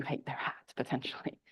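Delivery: soft sly whisper; whispered aside. Real, not acted. Whispered aside to the audience about hated hats.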